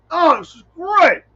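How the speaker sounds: high, slow, delighted "oh, this is great"; elated. Retold or imitated. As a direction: savor it as if floating on clouds. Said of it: "you're like".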